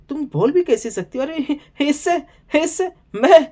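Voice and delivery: mock Kunika's indignant squeal. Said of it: "बोल रही है नीलम से".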